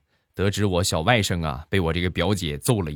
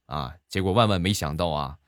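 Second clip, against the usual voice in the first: ordinary talk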